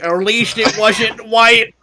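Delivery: mock dying shout. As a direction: shout it slowly through laughter. Hammond quote, acted.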